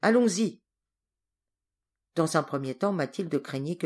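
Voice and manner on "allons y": loud, higher command; a leader rousing the hunters, loud; curt order. A narrator's reading. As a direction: bark the order to move out. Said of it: "Judoc ordonna".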